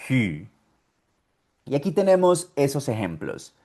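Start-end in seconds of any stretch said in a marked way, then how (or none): 0.1-0.5 "hü" with audible H, rounded ee; H with U umlaut. Shown as a demonstration. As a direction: model "hü" alone, lips rounded. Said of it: "La H con U umlaut".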